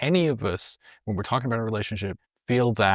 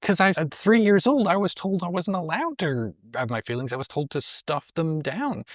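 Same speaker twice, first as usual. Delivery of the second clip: high mocking reply; 7 semitones higher than usual. Mock reply, pitched high.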